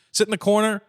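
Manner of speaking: ordinary talk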